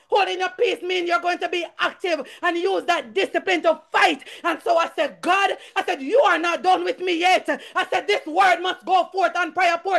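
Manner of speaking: high, forceful testimony; impassioned church preacher; fervent preaching. Real, not acted. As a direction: preach it with mounting intensity.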